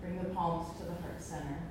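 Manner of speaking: soft, calm breathing guidance; guided meditation. Acted. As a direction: ease them into stillness.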